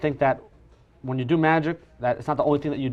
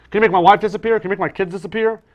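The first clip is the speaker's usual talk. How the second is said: higher, louder mock heckler; joking heckler; 7 semitones higher, 8 dB louder than usual. Restaurant guest joking to the magician, higher and louder. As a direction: ham up the tired old joke. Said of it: "someone has said to me".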